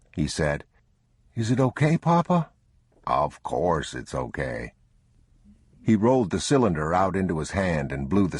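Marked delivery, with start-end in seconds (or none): 1.3-2.5 put-on much higher, worried child's question; a small boy needing reassurance; anxious boy. A narrator's reading. Boy asking his father about the pistol. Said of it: "the boy said". 3.1-4.7 slow, low, soft reassurance; calm the boy gently; reassuring father. A narrator's reading. Father reassuring his frightened son.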